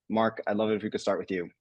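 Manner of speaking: ordinary talk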